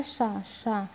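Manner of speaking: शा said quietly with clear sh hiss; quiet demo of palatal sha; soft sha demo. Shown as a demonstration. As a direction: softly model शा from the palate. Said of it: "चा वर्ग का उच्चारण जो तालु से होता है".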